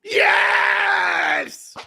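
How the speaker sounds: high, loud cry of joy; shouted yes. Real, not acted. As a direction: roar with relief. Final whistle celebration.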